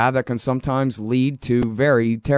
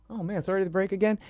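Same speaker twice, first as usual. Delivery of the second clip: surprised; 8 semitones higher, 8 dB quieter, much faster than usual. Real, not acted. Surprised the break has come.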